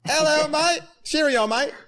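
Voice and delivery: high, loud hammed-up British accent; mock British accent. Host greets the British guest in a mock British accent. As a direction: play the cheeky Brit with a stage British accent. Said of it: "America's favorite thing to say is cheerio".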